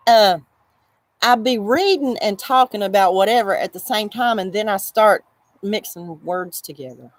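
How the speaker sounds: normal talking voice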